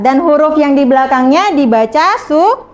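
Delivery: ordinary delivery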